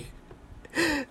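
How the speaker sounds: high laugh falling off; amused laugh. Real, not acted. Laughing at his own joke about Israel, high falling laugh. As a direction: chuckle at your own joke.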